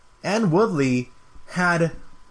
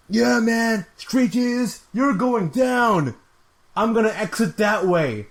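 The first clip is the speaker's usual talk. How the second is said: much higher, slow hammed-up taunt; imitating Colby; 6 semitones higher, slower than usual. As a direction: gloat it out like a cocky fighter. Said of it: "he's like".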